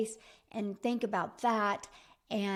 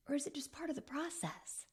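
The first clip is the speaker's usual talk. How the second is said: whisper; 3 semitones higher, 10 dB quieter than usual. Acted. Whispering a question to her viewers. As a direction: drop to a breathy whisper.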